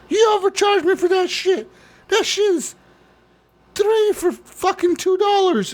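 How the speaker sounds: shrieking-style mock Karen at the till; angry customer. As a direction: caricature of a furious shopper complaining. Angry customer yelling at clerk.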